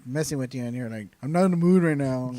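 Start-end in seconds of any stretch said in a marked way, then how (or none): 1.2-2.3 imitating a grumpy friend, raised pitch and louder; mock a friend in a bad mood; loud, higher mock-grumpy brush-off; grumpy retort. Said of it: "I was grumpy".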